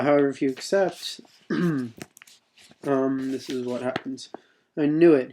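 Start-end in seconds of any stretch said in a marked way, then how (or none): 4.8-5.3 loud happy outburst; excited bellow. A narrator's reading. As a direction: boom it out, delighted. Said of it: "he bellows excitedly".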